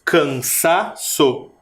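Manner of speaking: Cansaço slowly split, SA held; CAN-SA-ÇO in slow beats, leaning on SA; stress on SA. Shown as a demonstration. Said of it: "mas é o sa". (Cansaço devagar, destacando o SA)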